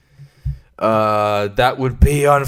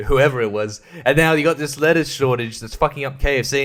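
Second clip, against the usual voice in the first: ordinary talk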